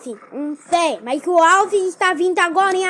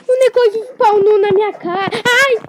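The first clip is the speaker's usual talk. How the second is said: startled yelling; 4 semitones higher, 8 dB louder than usual. Real, not acted. Monster spawned in face.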